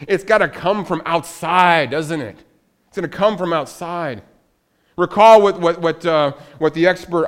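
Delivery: loud, rising pulpit preaching; full-force preaching. Acted. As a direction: preach it out to the congregation.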